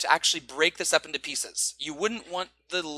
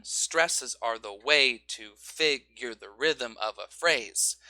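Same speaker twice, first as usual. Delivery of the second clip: slow, with "way" hit hard on the point; stress rhythm with pointing; 3 semitones lower, slower than usual. Shown as a demonstration. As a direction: mark each stressed beat. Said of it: "Pointing is one".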